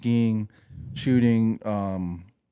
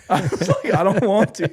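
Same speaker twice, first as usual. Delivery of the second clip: higher, louder, laughing through it; laughing refusal; 9 semitones higher, 6 dB louder than usual. Retold or imitated. Refusing the shots, laughing loudly. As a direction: protest while cracking up.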